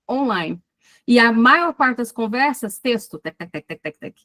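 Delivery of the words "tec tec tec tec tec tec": soft, low run of tongue-tapped tecs; imitated typing clicks. Teacher mimicking nonstop online texting, imitated typing clicks. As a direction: imitate keys tapping, quietly and evenly.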